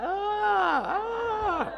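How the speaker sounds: high anguished cry of ah; mock anguished cry. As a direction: wail as if in pain. Mock cry about past sins.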